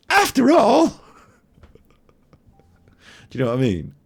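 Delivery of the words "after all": jumps way up, high and questioning; high squeaky echo. Retold or imitated. To the girl on the box, mocking high squeak. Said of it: "she thinks in her brain".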